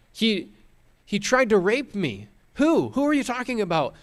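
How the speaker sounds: high, hammed-up cry of false distress; mock distressed wife. Wife fakes distress to the servants, high and frantic. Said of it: "And she says".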